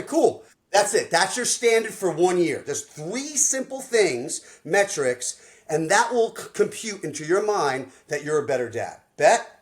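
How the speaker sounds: loud, high pumped-up coaching call; hyped coach. Retold or imitated. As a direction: pump him up like a coach closing the deal. Coach hyping client on call.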